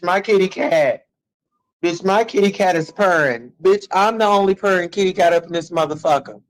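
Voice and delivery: ordinary delivery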